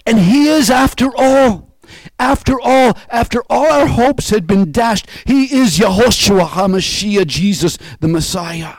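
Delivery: loud, soaring preacher's proclamation; full-force preaching. Acted. Preacher proclaiming the risen Christ to his congregation, full force. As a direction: lift the congregation with every line.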